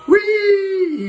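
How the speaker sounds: high squealing whee, joyful; gleeful whee. Acted. Toy pilot flipping upside down, high gleeful squeal. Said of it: "he says".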